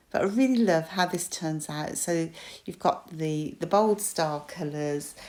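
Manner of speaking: usual speaking voice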